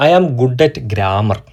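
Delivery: each word drawn out very slowly; slow dictation reading. Shown as a demonstration. Teacher giving a new example sentence, very slowly. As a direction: read the example out slowly and clearly. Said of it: "മറ്റൊരു ഉദാഹരണം കൂടി നോക്കാം".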